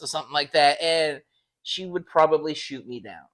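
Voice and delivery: ordinary delivery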